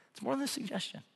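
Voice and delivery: ordinary talk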